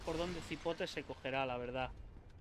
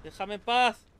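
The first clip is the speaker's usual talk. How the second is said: exasperated shout; 4 semitones higher, 10 dB louder than usual. Real, not acted. Boar keeps attacking.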